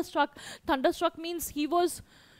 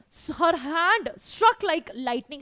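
normal talking voice

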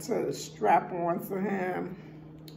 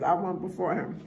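usual speaking voice

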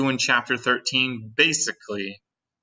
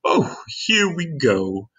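drawn-out, higher excited call; excited grasshopper shout; 5 semitones higher, much slower than usual. A narrator's reading. Old green grasshopper hopping with excitement, drawn-out shout. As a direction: stretch the words out with glee. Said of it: "shouted the old green grasshopper".

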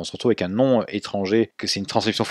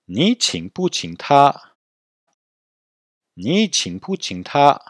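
very slow, higher-pitched Mandarin with drawn-out tones; slow repeat-after-me model; 5 semitones higher, much slower than usual. Shown as a demonstration. Teacher has students repeat the question, slow model. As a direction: stretch each syllable for learners to copy. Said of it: "Répétez".

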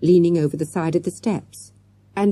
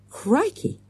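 exclaimed; 11 semitones higher, 3 dB quieter than usual. A narrator's reading. Morgan spotting the other bearded man, startled exclamation. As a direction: blurt it in high surprise. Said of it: "exclaimed Morgan".

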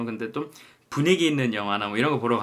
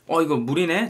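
mock surprise; 7 semitones higher, 5 dB louder than usual. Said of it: "제가 이러면 옆에서".

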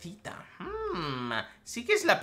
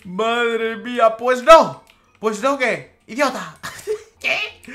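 loud, high, laughing disbelief; shocked outrage; 7 semitones higher, 12 dB louder, slower than usual. Real, not acted. Gamer yelling at the scammer who left, high and loud.

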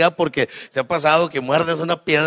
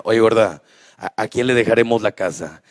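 lower, gruff husband asking his wife; old husband voice; 8 semitones lower than usual. Retold or imitated. Husband asks wife who gets the house. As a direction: drop the pitch like an aging dad.

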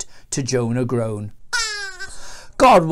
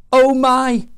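high, loud moan; acted "woe is me" cry; groaned dismay; 9 semitones higher, 8 dB louder than usual. A narrator's reading. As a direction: groan it out in alarm. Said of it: "Jonah groaned".

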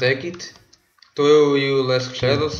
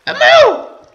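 very high, loud gleeful shout; excited shout; 8 dB louder, much slower than usual. Real, not acted. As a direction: shout it out, claiming the prize. Finds a diamond, shouting.

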